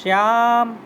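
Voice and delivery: calling out names. Shown as a demonstration. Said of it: "जैसे कि हम किसी को बोलते हैं".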